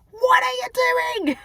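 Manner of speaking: high, slow mock disbelief; mock shocked. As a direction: gasp it out like a stunned parent. Parent finding child misbehaving.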